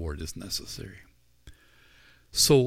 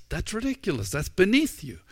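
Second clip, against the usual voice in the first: higher, louder protest; indignant Peter; 10 semitones higher, 3 dB louder than usual. Acted. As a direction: play Peter scandalized. Peter protests Jesus washing feet.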